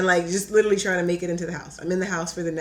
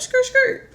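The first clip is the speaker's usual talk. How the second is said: high squealing skid noise; tire screech; 6 dB louder than usual. Retold or imitated. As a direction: mimic tires skidding to a stop. Imitating car screeching into driveway.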